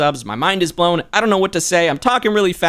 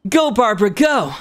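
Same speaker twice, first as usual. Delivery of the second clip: high, energetic character cheer; higher, urging character shout-out; Child voice; 6 semitones higher than usual. Acted. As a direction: pitch it up and cheer her on. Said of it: "I'll say it in Child's voice for you".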